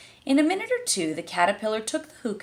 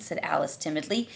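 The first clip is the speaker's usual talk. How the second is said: ordinary delivery, nothing marked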